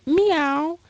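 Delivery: high playful meow; cat meow. Pretend. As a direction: squeak it like a cat. Cat on card says meow.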